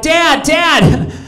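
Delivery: put-on high, eager little kid voice; son's voice. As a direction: play the excited young son tattling to dad. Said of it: "he'd be like".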